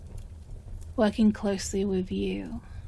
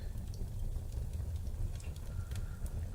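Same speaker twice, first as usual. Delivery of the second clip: long soft sigh, barely audible; whispered sigh; 13 dB quieter than usual. Acted. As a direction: let out a slow hushed sigh. Sighs over bound captive.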